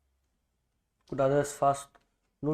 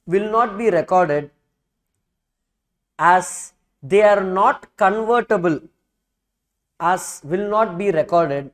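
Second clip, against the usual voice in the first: dictating. Shown as a demonstration. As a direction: read it out phrase by phrase for notes. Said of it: "write what I am dictating".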